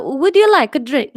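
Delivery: put-on Italian accent that slips into Transylvanian; loud hammy Italian accent offering a drink. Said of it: "it always goes kind of Transylvanian, the Italian".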